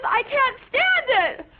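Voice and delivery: put-on teen wailing she can't stand them; exasperated cry. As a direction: wail it out at them. Exasperated outburst at parents.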